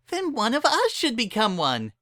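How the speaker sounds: eager Pinkie pitching in; Pinkie Pie voice. Acted. Pinkie urges friends to help, bubbly.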